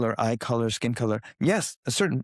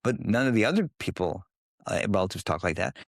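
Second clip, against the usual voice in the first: usual speaking voice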